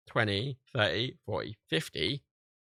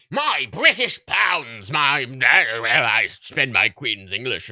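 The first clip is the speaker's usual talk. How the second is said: slow, much louder pompous Brit voice; mock gammon; 2 semitones higher, 10 dB louder, much slower than usual. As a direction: ham up a pompous patriotic Brit. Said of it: "makes me sound like a fucking gammon".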